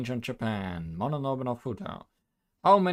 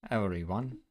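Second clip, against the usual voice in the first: formal speech; 3 semitones lower than usual. Acted. Lady Taishi addressing the gathered yokai, formal speech. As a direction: speak like a leader addressing troops.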